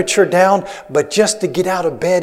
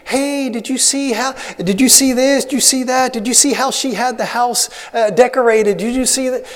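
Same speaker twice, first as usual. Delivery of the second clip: high-pitched imitation of an excited wife; mock wifely chatter, much higher; 7 semitones higher than usual. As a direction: play the wife gushing with questions, pitched up. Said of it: "so she begins".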